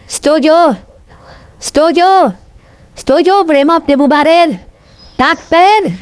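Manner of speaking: high, insistent crowd call; rallying call. Real, not acted. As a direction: demand a shout of agreement in a high voice. Demanding assent from the crowd. (seruan tinggi menggalang persetujuan massa)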